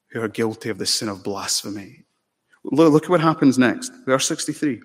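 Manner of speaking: ordinary talk, nothing marked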